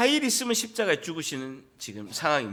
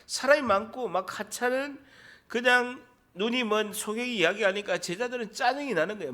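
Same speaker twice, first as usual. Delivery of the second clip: normal talking voice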